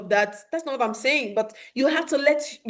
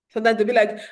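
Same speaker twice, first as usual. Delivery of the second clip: usual speaking voice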